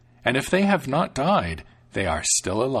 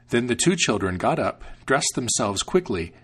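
ordinary delivery, nothing marked

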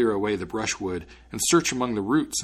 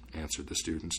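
ordinary delivery, nothing marked

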